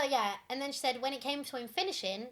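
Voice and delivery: ordinary talk, nothing marked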